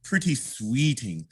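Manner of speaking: slow, drawn-out sweet talk; slow, charming recitation; wooing storytelling. Shown as a demonstration. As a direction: woo her, stretching each word. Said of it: "you gotta help him tell".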